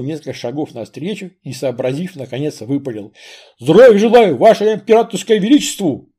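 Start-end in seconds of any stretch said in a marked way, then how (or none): 3.6-6.1 оглушительно рявкнуть по-солдатски; громко, как на плацу; громкий бравый солдат. Acted. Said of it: "Прямо оглушил". (солдат громко рапортует царю)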